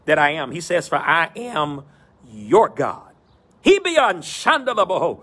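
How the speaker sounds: rising preacher cadence, building phrase by phrase; preaching. Acted. Pastor preaching to his virtual church, in a rolling preacher cadence. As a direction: preach it in a rolling call and response rhythm.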